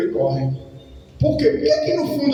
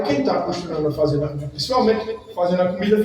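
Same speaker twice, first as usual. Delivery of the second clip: normal talking voice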